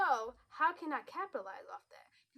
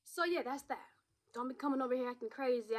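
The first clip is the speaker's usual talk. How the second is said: ordinary talk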